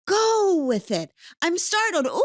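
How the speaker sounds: high, loud mock startle; playful startle. Shown as a demonstration. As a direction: bounce into the startle like a ride. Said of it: "Hannah taught me after that, I can just".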